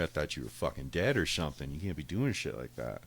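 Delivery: ordinary talk, nothing marked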